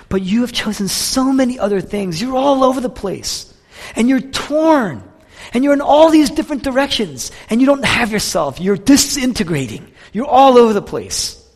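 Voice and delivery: high, urgent, insistent run; impassioned confrontation. Acted. Jesus telling Martha she is all over the place, impassioned. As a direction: press each charge home, pitched well up.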